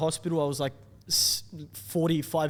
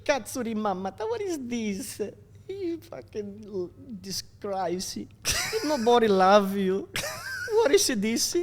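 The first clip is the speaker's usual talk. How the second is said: higher, hammed-up scolding mother; angry mum; 7 semitones higher, slower than usual. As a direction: tell him off like an outraged mum.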